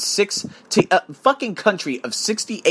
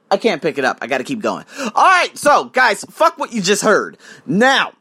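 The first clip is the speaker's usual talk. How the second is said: ordinary delivery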